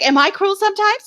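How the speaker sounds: lightly mocking high-pitched question; mimicking her. Host mimics the woman who called her cruel, mock-innocent question. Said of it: "And then she's like".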